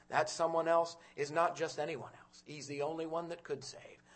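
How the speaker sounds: ordinary talk, nothing marked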